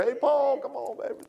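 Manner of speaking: high playful call; mock holler at a buddy; hollering across the foyer. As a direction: call out to a friend across the room. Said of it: "you gotta holler out there".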